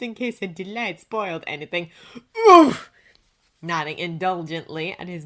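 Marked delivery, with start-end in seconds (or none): 2.5-2.9 sudden loud, high "oof!"; sharp startled oof; pained "oof". A narrator's reading. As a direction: grunt as if the wind is knocked out. Overheard wince during jarring landing.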